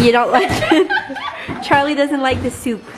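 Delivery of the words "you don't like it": laughing. Real, not acted. Child rejects soup.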